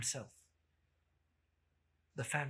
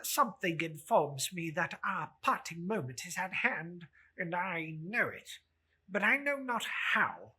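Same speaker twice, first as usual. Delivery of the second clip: pleading Scrooge; 3 semitones higher than usual. Acted. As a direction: stammer it out as an anxious old miser.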